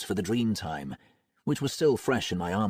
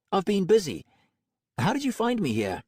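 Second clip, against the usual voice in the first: brash tough woman's voice; Roxy Hazard voice; 11 semitones higher than usual. Acted.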